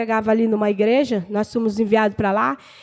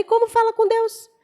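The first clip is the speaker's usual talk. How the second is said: high-pitched questioning retort; retold challenge; 10 semitones higher than usual. New convert asking the church sister how to pray, retold questioning retort. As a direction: ask it back, pitched well up. Acted puzzled reply to the rebuke.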